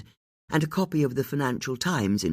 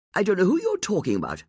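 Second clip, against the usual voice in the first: rushed; 8 semitones higher, faster than usual. A narrator's reading. Hurried denial under questioning.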